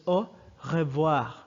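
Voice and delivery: "au revoir" with both words in full; formal French "au revoir", "revoir" not shortened; formal full pronunciation. Shown as a demonstration. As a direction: say every syllable of "au revoir", French guttural R. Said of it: "formally it's pronounced as".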